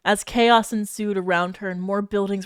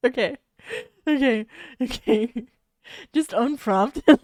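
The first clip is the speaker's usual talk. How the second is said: normal talking voice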